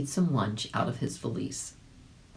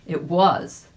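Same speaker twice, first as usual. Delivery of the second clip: ordinary delivery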